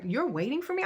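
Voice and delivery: high, indignant question; angry disbelief. Retold or imitated. Confronting him for waiting up, angry disbelief. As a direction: throw it back at him, incredulous. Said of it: "I was very angry".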